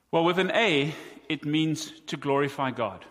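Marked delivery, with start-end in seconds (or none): none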